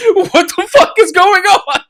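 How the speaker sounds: high squealing laugh-talk; cracking up, pitch way up; laughing disbelief. Real, not acted. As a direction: barely get it out through high laughter. Cracking up retelling game shock.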